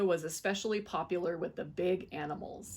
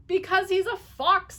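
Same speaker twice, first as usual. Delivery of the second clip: high, loud insistence; emphatic; 11 semitones higher, 9 dB louder than usual. A narrator's reading. Dentist insisting to his wife. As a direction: drive the point home. Husband answers firmly.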